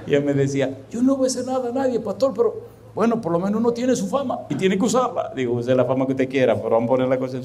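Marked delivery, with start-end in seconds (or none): 0.9-5.3 high, put-on voice making excuses; imitated defensive protest. Church member defending themselves to the pastor, imitated protest. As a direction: protest in a much higher borrowed voice.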